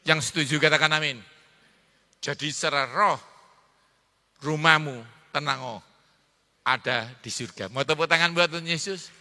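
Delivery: ordinary delivery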